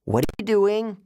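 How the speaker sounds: high, urgent demand; yelled question. A narrator's reading. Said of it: "Annabeth yelled".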